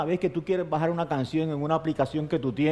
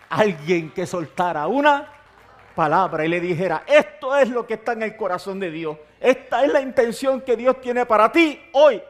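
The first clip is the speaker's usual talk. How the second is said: rising, louder and higher, driving home the word; preaching at full force; 7 semitones higher, 5 dB louder than usual. Acted. Pastor urging the congregation, preaching at full force. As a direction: preach it like a climax.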